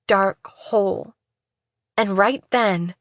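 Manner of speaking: ordinary delivery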